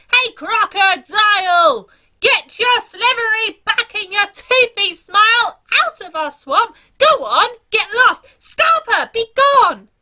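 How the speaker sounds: high, loud, commanding yell; shouting. Acted. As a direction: chase the croc away with a big shout. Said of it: "he shouted".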